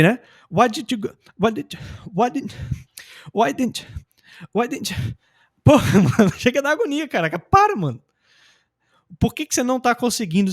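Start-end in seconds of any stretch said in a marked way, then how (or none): 0.5-4.9 higher-pitched stuttering restarts, breaking off each time; stuck like a DJ. Shown as a demonstration. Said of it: "Aí virou um D J". 5.6-8.0 loud, high-pitched outburst with a laugh; mock exasperation. Acts exasperation at stuck learner.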